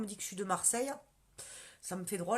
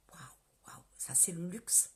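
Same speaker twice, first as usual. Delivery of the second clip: dreamy whisper; 3 semitones lower, 7 dB quieter than usual. Real, not acted. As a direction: whisper it like a dream come true. Rêverie sur le luxe, chuchotée. (chuchotement rêveur et lent)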